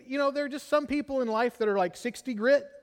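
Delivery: ordinary delivery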